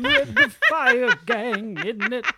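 slow, high, loud mock [name] through a laugh; [name] impression. Cohost answering with her own laughing [name] voice. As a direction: drag it out like a camp goblin king. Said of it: "These are great [name] impressions".

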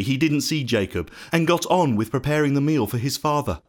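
ordinary delivery, nothing marked

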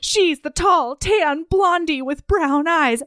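high, drawn-out mock dismay; retold realization. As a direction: act out the penny dropping. Acting out Lucy's dawning realization.

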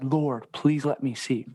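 ordinary talk

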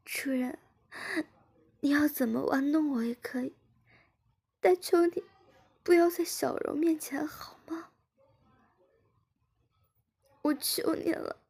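high, tearful begging; pleading through tears. A narrator's reading. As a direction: beg her master, voice near breaking. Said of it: "志敏边哭边说". (高声哭着哀求)

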